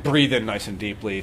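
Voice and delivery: ordinary talk, nothing marked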